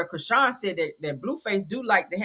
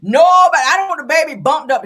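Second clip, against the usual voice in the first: louder and higher, protective insistence; emphatic outburst; 7 semitones higher, 10 dB louder than usual. Real, not acted.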